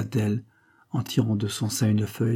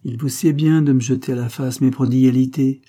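narrated anger, raised and louder; angry retort; 4 semitones higher, 6 dB louder than usual. A narrator's reading. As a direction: throw it back with contained anger. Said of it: "répliqua Don José avec colère". (réplique en colère, voix haussée)

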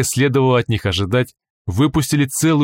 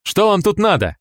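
hostile guard; 11 semitones higher than usual. Acted.

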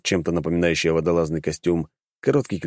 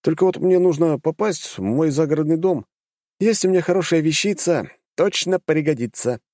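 put-on higher, lively young man voice; confident young hero; 9 semitones higher than usual. A narrator's reading. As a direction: voice a cocky young mage.

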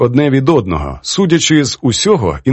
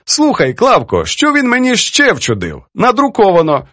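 high, lively storytelling in character; animated old poetess; 9 semitones higher than usual. Acted. Poetess reads editor's letter to her friend, mockingly. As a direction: relish the editor's pedantry in a high voice.

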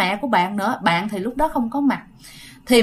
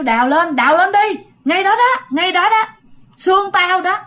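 high, raised calls to dig right there; commanding shout; 7 semitones higher than usual. Retold or imitated. Spirit orders the family to dig up its bones, commanding. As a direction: point and order them to dig. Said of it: "kêu".

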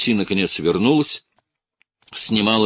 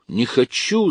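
higher, rising cry; 8 semitones higher, 4 dB louder, slower than usual. A narrator's reading. Lucy refuses, clutching her head, crying out. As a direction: repeat it higher, escalating. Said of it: "закричала Люси".

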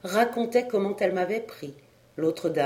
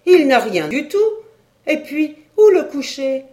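much louder and higher, "Il n'a rien du tout" cried out; "rien" and "où" thrown up high in alarm; wife crying out in alarm about the child; loud exclamation; 7 semitones higher, 9 dB louder than usual. A narrator's reading. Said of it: "s'écria la femme".

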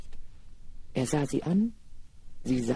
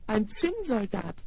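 surprised call; 5 semitones higher than usual. Acted. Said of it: "rief da eine Stimme".

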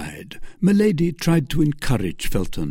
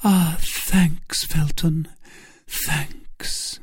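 grateful Milady; 7 semitones higher, much slower than usual. Acted. Milady thanking Felton after her rescue, high and grateful. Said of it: "asked Milady".

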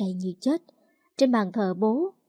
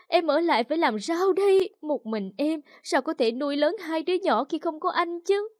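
higher, hammed-up wailing lament; wailing widow; 6 semitones higher than usual. A narrator's reading. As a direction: overdo the grief for show. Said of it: "bà ta khóc tưởng chừng như muốn chết ngất". (khóc than giả tạo, giọng cao)

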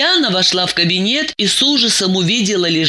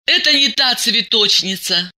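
mild, hopeless protest in a raised pitch; hopeless mumble; 6 semitones higher than usual. A narrator's reading. Diana keeps denying to Masha, hopeless mumble. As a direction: repeat the denial with little hope left. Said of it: "уже без всякой надежды промямлила Диана".

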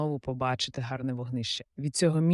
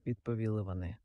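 ordinary delivery, nothing marked

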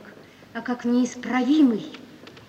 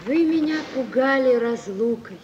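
usual speaking voice

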